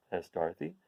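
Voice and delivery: ordinary delivery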